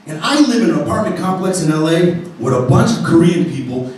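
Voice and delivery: usual speaking voice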